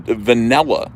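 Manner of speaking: vanilla said va-NELL-a; Jersey vanilla. Shown as a demonstration. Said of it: "That's Jersey".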